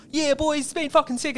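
put-on high, excited mate-with-the-boys voice; hyped bro voice. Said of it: "change your whole cadence of talking".

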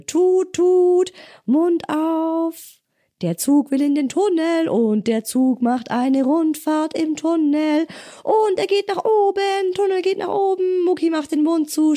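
squeaky high choo-choo voice; high playful train game. Acted. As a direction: play train into the tunnel.

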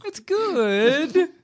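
very high, rising, doubtful 'good'; squeaked-up unconvincing compliment; bad good. Shown as a demonstration. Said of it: "give them the bad good".

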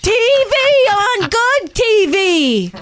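loud high mock gushing fans; impressed crowd. Comic mocking fans, loud high gush. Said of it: "and people go".

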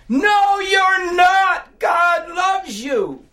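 very high, loud tearful shout; answering voice shouting. Retold or imitated. A voice shouts back to the despairing inmate, high and loud. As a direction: shout back the reassurance, slow at the end. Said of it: "this voice comes back".

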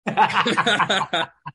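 big burst of laughter; loud laugh. Real, not acted. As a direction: laugh out loud, caught off guard. Laughs at Drew Kirk joke.

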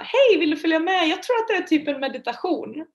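retelling her upbeat phone invite; eager invitation. Retold or imitated. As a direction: cheerfully talk a friend into coming. Retold call to her friend.

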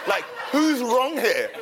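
flustered, high and laughing. Retold or imitated. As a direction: act flustered and confused through giggles. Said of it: "I was so flustered".

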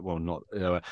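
ordinary talk, nothing marked

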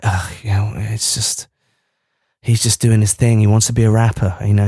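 low, resigned sigh of a parent; given-up parent. Acted. Podcaster playing parents who've given up on their kid, low resignation. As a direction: drop low, as if you've stopped caring. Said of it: "parents who've given up on their kid".

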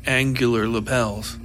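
plain reading voice

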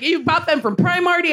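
high, sneering jab; mocking heckler. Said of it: "he's like".

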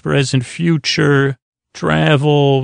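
ordinary talk, nothing marked

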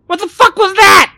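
very high, shouted outburst of horror; spat out. A narrator's reading. Millie rounds on Barry after the shadow, spitting it out. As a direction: spit it out, loud and aghast. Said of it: "spat Millie".

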